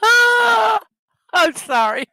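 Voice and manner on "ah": high loud startled ah; startled shriek. Real, not acted. Streamer yelps, startled, mid-stream. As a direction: yelp in sudden surprise.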